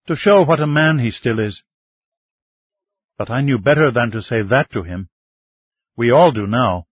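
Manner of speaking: lightly voiced young woman. A narrator's reading. Ming continues in her voice.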